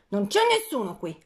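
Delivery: usual speaking voice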